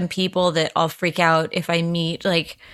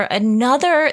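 normal talking voice

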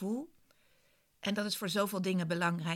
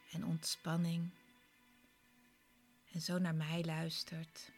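guided meditation. Acted. Leads a guided relaxation.

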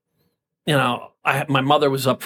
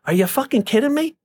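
incredulous; 12 semitones higher than usual. Retold or imitated. Retold incredulous reply to people.

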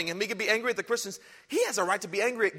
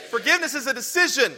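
louder call and response; 5 semitones higher, 5 dB louder than usual. Shown as a demonstration. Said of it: "I didn't hear you, balcony".

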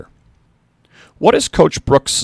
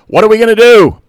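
high, loud rallying cry; yelled; 11 semitones higher, 12 dB louder than usual. A narrator's reading. Coach firing up the locker room, loud high yell. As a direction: pump the room up, shout it. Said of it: "He yelled".

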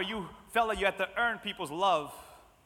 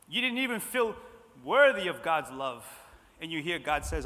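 ordinary talk, nothing marked

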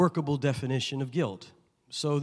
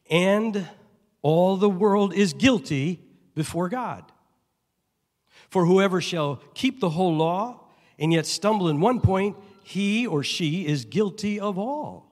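scripture reading; 5 semitones higher, 5 dB louder than usual. Acted. Pastor reading Romans to the congregation, solemn and raised. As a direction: pronounce the verse from on high.

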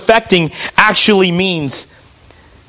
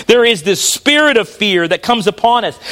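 normal talking voice

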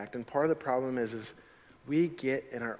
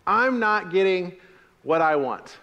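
high, loud, quick recital; loud call and response; 5 semitones higher, 6 dB louder than usual. Shown as a demonstration. Pastor leading the congregation in repeating the line, loud. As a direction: lead the congregation in saying it. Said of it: "You can leave that stuff up there".

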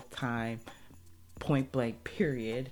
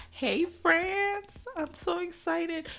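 much higher, slow thrilled "Hey, France, I'm so excited."; excited squeal; much slower than usual. Real, not acted. Greeting French listeners with a high excited squeal.

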